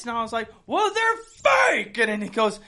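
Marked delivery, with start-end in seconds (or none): 0.7-2.0 very high, stretched-out retort; hammed-up squabbling; mock arguing. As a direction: drag out the comeback in a high squawk. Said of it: "We sound like we're in an argument".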